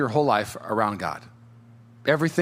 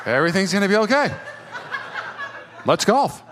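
quick, amused repeat through laughter; laughing echo; 6 semitones higher than usual. Retold or imitated. Pastor retelling his mentor's words, laughing incredulously. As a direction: echo the line like you can't believe it.

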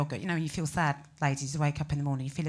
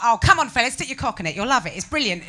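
blokey advert voice; 5 semitones higher, 10 dB louder than usual. Pretend. Mock advert aimed at blokes.

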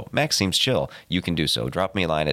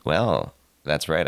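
ordinary talk, nothing marked

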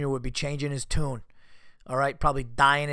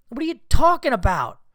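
loud, high-pitched incredulous outburst; fed-up fan raising his voice in disbelief; exasperated; 10 semitones higher, 8 dB louder than usual. Real, not acted. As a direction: demand it in real exasperation. Ranting at player claiming tiredness.

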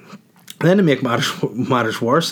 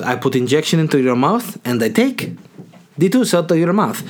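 put-on foreign-accented broken English; imitated dentist's accent; 5 semitones higher than usual. Dentist explains the extraction to patient, put-on foreign accent. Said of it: "why I went Russian".

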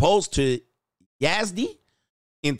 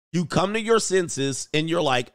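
usual speaking voice

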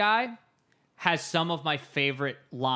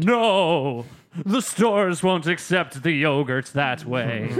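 loud, slow, grave tree creature; hammed-up ancient tree voice; ancient prophesying tree; 5 dB louder, slower than usual. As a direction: proclaim it with mock-epic gravitas. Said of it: "an eight thousand-year-old tree".

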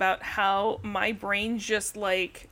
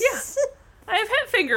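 hat said higher and brighter; mock excited; 10 semitones higher than usual. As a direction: lift the word hat much higher. Repeating the phrase, higher.

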